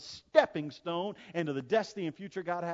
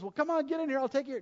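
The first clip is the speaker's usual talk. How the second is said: boat rescuer calling; 9 semitones higher, faster than usual. Retold or imitated. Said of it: "the guy in the boat says".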